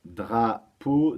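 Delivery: drapeau split slowly into DRA and PEAU; syllable by syllable. Shown as a demonstration. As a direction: say "drapeau" in two slow, separate beats. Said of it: "deux syllabes".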